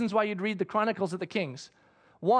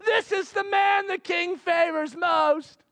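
herald proclamation; 8 semitones higher, slower than usual. Retold or imitated. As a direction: announce it to the whole street.

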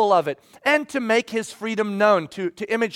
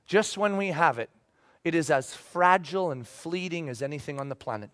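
musing softly; 6 semitones lower, 6 dB quieter than usual. Retold or imitated. Pastor musing alone while driving, low and soft. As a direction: muse to yourself in the car. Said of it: "driving over here and going".

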